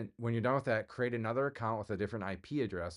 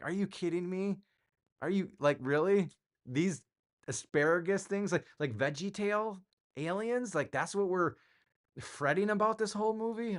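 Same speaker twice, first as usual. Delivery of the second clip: higher, retold incredulous rant; playful exasperated disbelief at the alien reveal; mock disbelief; 9 semitones higher than usual. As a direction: act baffled by the silly alien ending. Retelling disbelief at movie ending.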